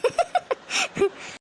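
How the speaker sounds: a high burst of laughter. Real, not acted. Laughs at complaints. (உயர்ந்த குரலில் வெடிச்சிரிப்பு)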